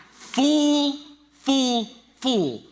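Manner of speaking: high, loud "fool" fading each time; mock scolding. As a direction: call out the fool, dying away. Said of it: "pastor's punchline".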